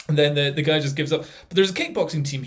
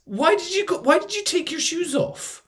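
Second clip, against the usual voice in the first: high, baffled why-would-you question; incredulous; 13 semitones higher than usual. Real, not acted. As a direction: ask it in total disbelief. Incredulous at the rescuers.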